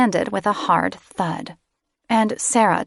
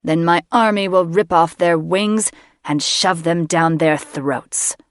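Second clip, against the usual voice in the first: louder, cruel gloating; gloating mage; 4 dB louder than usual. A narrator's reading. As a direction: savour the threat. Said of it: "Eagleheart laughed".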